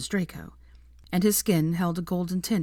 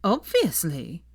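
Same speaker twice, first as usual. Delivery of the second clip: slow, drawn-out scoff; much slower than usual. A narrator's reading. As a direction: roll it out with contempt. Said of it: "Sophia scoffed".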